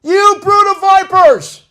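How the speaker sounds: very high, slow, full-force prophetic shout; enraged shout. Acted. Preacher shouting as John the Baptist at the people, enraged. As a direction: roar it with righteous indignation. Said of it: "That's how he said it too".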